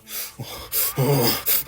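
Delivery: hammed-up breathy groan; exaggerated sighs of pent-up urge; mock straining sighs. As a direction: act out barely holding it in. Mimes activists' uncontainable urge.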